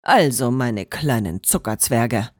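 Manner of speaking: low, stern teacher address; a mild touch of sternness. A narrator's reading. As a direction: Enzo addressing his elves in a low stern voice. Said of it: "sagte Enzo streng".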